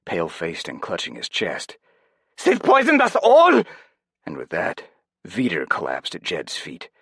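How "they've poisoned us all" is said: put-on very high, loud German-accented scream; scream in broken English. A narrator's reading. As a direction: scream it in panic. Veeder's continued panic.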